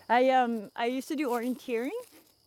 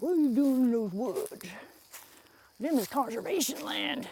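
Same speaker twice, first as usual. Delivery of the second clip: hammed-up country twang, higher; mock rural neighbor; 5 semitones higher than usual. Neighbor scolding trespasser in woods, mock rural twang. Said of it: "neighbors come out and be like".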